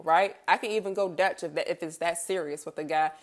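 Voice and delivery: normal talking voice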